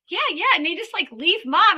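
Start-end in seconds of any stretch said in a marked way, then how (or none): 0.1-0.5 put-on eager child. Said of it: "And they're like".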